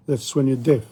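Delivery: ordinary delivery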